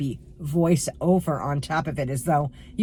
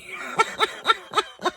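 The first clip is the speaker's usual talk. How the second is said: real high cackling giggle; high-pitched laugh. Real, not acted. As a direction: laugh helplessly at your own cattiness. Laughs at her own cattiness.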